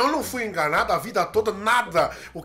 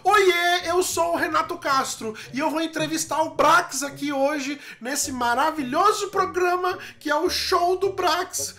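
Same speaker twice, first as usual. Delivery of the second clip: mock show-host introduction, pitched way up; imitating his guest; 6 semitones higher than usual. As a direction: mimic the guest in a raised, showy pitch. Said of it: "Você tá me imitando?".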